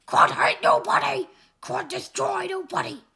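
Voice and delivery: loud angry bellow; furious monster yell; brute shout. Acted. Crud bellows a denial.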